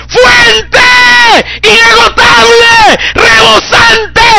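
high-pitched full-force preaching shout; grito ferviente. Real, not acted. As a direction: shout it with drawn-out, fervent words. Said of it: "Es lo que tú vas a gritar". (predicación a gritos)

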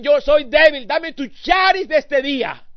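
high, loud crying out for daily grace; desperate pleading prayer. Retold or imitated. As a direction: beg God loudly and slowly.

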